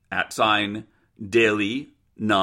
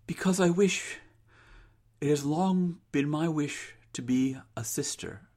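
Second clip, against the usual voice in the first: soft, higher voice with pauses; hesitant daughter; 5 semitones higher, 6 dB quieter than usual. Acted. Rose confesses her wish to mother, soft and hesitant. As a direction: confess a long-held wish haltingly.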